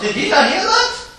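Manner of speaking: high, loud mocking glee of the critics; gloating critics. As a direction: crow it like you've caught him out. Critics gloating, acted quote.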